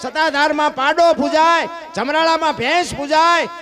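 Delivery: rousing preacher's list; fervent preaching. Real, not acted. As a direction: hammer each example home. Listing worshipped animals.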